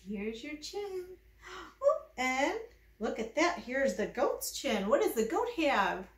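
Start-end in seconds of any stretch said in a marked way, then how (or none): none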